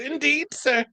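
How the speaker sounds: high, put-on Scottish lady's accent. A worker mimics a Scottish customer's reply, high Scottish accent. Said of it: "You from Scotland?".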